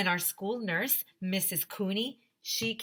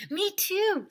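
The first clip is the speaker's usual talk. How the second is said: put-on high eager chorus of kids; kids chiming in; 13 semitones higher, 4 dB louder than usual. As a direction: pipe up together like a bunch of kids.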